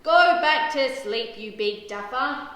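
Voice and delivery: loud, slow shout, easing off at the end. A narrator's reading. Dad to the howling dog at night, shouting. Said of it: "Dad shouts".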